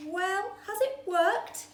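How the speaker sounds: high, eager question. A narrator's reading. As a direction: ask eagerly. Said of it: "asked Tiny eagerly".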